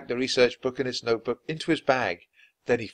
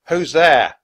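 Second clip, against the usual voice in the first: loud, raised captain's challenge; shouting; 7 semitones higher, 12 dB louder than usual. A narrator's reading. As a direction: shout out at an unseen intruder. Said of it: "the captain shouted".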